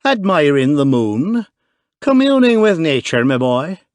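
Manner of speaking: mock the question in a broad Irish accent; pure Irish. A narrator's reading. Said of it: "he cried in pure Irish".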